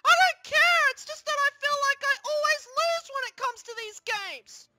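high wail, loudest at the start; upset tantrum. Acted. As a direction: burst out in high-pitched frustration. Upset loser laments always losing.

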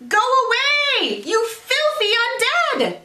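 high, slow shout at the zombies; boy shouting. Acted. Jack under his pillow shouts at the zombies, high. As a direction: drive the monsters off, high and pleading.

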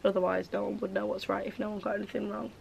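ordinary talk

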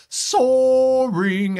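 "soaring" stretched long, high and loud; SOARING drawn out. Real, not acted. Forecaster showing off the heat, SOARING drawn out high. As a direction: belt out "soaring" on a long held high note. Weatherman hams up rising temperatures.

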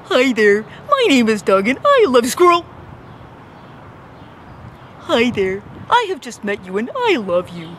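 overeager dog, bursting with love; squeaky rubber duck. Retold or imitated. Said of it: "do your Doug impression".

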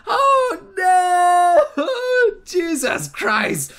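high, drawn-out "oh no"; horrified wail. Real, not acted. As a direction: wail it in dismay. Still reacting to the scene.

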